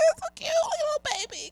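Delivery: put-on very high baby-talk coo; high-pitched adoring coo. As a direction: gush softly over an adorable baby. Cooing over the cute child.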